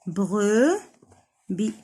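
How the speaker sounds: بروبي as "bre", pause, "bi"; syllable by syllable. Shown as a demonstration. Teacher sounding out a word for children, بروبي broken in two. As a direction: slowly stretch بروبي into two pieces. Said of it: "دو سيلاب".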